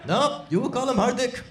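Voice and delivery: hoher, kräftiger indischer Akzent einer Mutter; Indian accent. Retold or imitated. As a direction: resolute Indian mom, hammed-up accent. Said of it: "that accent might seem offensive".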